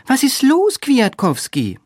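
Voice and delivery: very high-pitched questioning voice; Olga. A narrator's reading. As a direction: ask it high, drawing out the name. Olga questions her friend.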